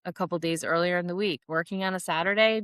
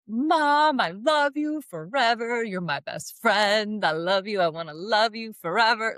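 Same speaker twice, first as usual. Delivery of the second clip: gushing daughter; 4 semitones higher than usual. Retold or imitated. Voicing her gushing daughter.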